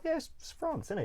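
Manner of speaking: high-pitched mock innocence; mock naive self. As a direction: play your past self as cheerfully oblivious. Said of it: "I was just like".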